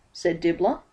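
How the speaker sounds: usual speaking voice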